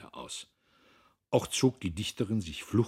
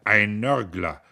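louder, scornful snort of a word; indignant snort; snorting grumble; 3 semitones higher, 11 dB louder, slower than usual. A narrator's reading. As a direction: spit it out with contempt. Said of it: "schnaubte Dietrich".

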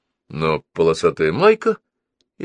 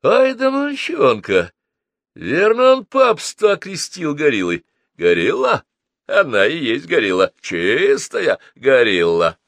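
high, stretched mocking exclamations; uproarious amusement; 8 semitones higher, 4 dB louder than usual. A narrator's reading. Mercenaries roaring at the boy's nickname, uproarious amusement. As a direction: crow with delight at the nickname. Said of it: "Наёмники грохнули".

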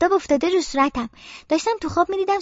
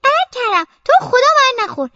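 much higher, louder tiny rabbit begging; a small pretend rabbit begging the bear; little rabbit pleading; 9 semitones higher, 6 dB louder than usual. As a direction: squeak a plea not to be eaten. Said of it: "خرگوشه میگه".

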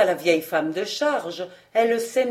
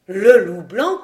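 loud startled exclamation; alarmed cry; 7 dB louder, slower than usual. A narrator's reading. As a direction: gasp out the dreaded name loudly. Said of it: "s'écrièrent ensemble".

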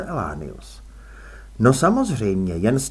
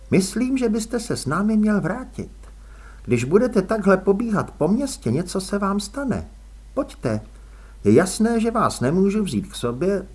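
put-on higher, brisk no-nonsense young woman; young woman, firm; 7 semitones higher than usual. A narrator's reading. Růžena urges Elánius back, firm young woman. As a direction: voice Růžena as sharp and sure.

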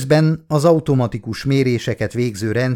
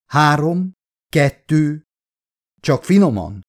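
tense; 5 semitones higher, 4 dB louder, slower than usual. A narrator's reading. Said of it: "mondta feszülten a kapitány".

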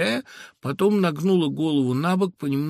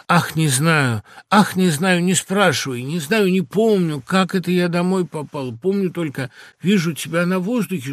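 louder, higher, hurried protest; agitated outburst; 4 semitones higher, 6 dB louder than usual. A narrator's reading. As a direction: brush the questions off, overwhelmed. Overwhelmed girl answers reproach in story. (взволнованно, громче и выше)

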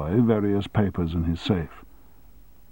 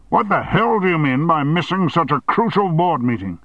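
higher, forceful rebuke; angry scolding; 9 semitones higher, 3 dB louder than usual. Acted. Chairman scolding his sick deputy, angry. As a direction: tear into him.

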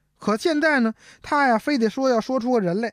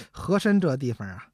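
ordinary talk, nothing marked

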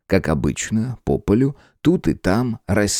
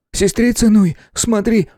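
put-on higher boy's voice, alarmed; 14 semitones higher than usual. A narrator's reading. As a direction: call out in fright. Boy calls out alarmed.

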